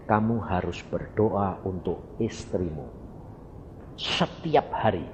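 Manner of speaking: lebih rendah dan lebih pelan, suara Tuhan yang berpesan. Retold or imitated. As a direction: tirukan Tuhan memberi pesan dengan tenang dan rendah. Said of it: "pesen Tuhan gini". (pendeta menirukan pesan Tuhan kepadanya, rendah dan lembut)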